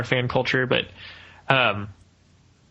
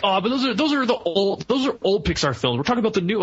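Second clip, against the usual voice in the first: mock fan objection; 8 semitones higher than usual. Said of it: "hardcore Pixar fans are like".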